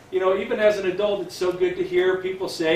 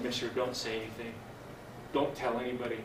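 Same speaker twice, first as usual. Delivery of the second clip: hushed plea; 4 semitones lower, 11 dB quieter than usual. Retold or imitated. Girl begging him to stay silent.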